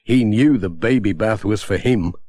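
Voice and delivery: ordinary delivery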